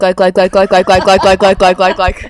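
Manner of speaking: loud, laughing run of like like like; deliberate rapid likes. Shown as a demonstration. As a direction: spam the filler word on purpose, loud and giggly. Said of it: "I'm gonna say it a lot now".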